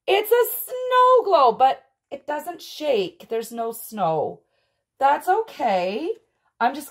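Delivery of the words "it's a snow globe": excited. Real, not acted. Reveals the first surprise.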